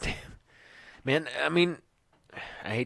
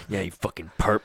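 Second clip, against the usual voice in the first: mock cop sneer; 3 dB louder than usual. Mimicking a cop insulting someone.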